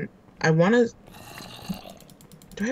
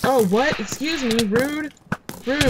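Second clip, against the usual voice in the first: mock offended; 7 semitones higher, slower than usual. Offended by a mob attack.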